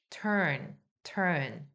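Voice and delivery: turn을 R 굴린 어로 천천히; turn의 어를 혀 말아 발음; R-colored er. Shown as a demonstration. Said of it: "이런 식으로 어인데". (R 붙은 어 발음 시범)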